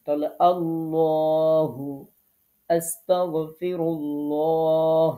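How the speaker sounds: আল্লাহু and আস্তাগফিরুল্লাহ chanted slowly, ল thick; slow tajweed recitation, full rounded lam; recitation with heavy lam. Shown as a demonstration. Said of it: "এটা হচ্ছে পোর করে পড়লাম". (পোর করে ধীরে তিলাওয়াত)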